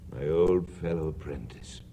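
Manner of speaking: soft, tender remembering; quietly touched memories; fond, hushed. Acted. Old Scrooge fond, hushed.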